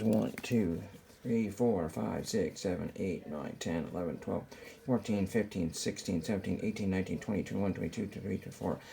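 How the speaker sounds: quiet muttered counting; counting under breath. Real, not acted. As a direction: count softly to yourself.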